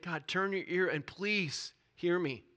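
slow, high-pitched plea; pleading. Acted. Preacher voicing Daniel begging God, pleading. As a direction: beg God to listen, word by word. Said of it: "He is pleading with God".

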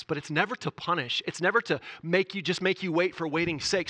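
normal talking voice

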